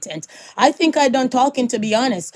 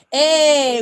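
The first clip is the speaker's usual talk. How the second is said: usual speaking voice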